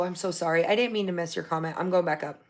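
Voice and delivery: usual speaking voice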